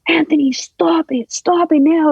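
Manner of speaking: high, drawn-out plea without much volume; retold desperate cry to stop; imitated screaming. Mom pleading with her attacker, imitated screaming. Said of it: "I heard my mom screaming".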